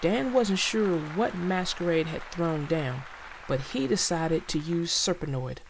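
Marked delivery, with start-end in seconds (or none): none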